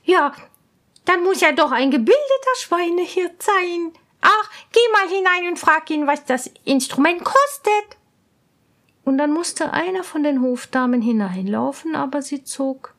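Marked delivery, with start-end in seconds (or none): 0.0-8.0 higher, eager princess; an excited princess sending her maid. Acted. Princess orders a lady-in-waiting, high and eager. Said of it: "sagte die Prinzessin".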